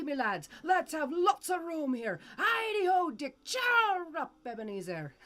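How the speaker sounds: merry, high calls to the lads; rally the apprentices cheerfully; jovial Fezziwig. A narrator's reading. Fezziwig urges Dick and Ebenezer on, jovial calls. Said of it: "cried old Fezziwig".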